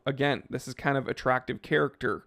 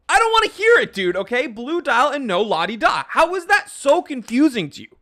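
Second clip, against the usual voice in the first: loud, much higher hammed-up yelling; mock shouting; 8 semitones higher, 6 dB louder than usual. Vlogger shouting at confused viewers, hammed-up and loud. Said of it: "I can't even hear myself".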